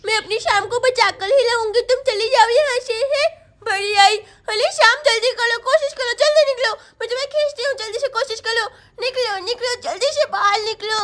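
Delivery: ordinary delivery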